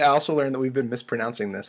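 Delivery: usual speaking voice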